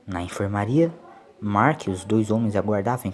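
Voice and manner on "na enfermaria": ordinary delivery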